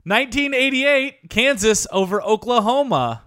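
ordinary talk

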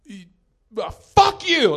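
high, loud retold outburst; defensive. Comic retells snapping at the boys, defensive outburst. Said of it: "he just went defensive".

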